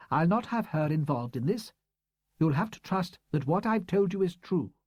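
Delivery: a hint of a proud, hurt old teacher; woman's voice. A narrator's reading. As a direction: read her as a composed, defensive schoolteacher. Woman's voice in the story.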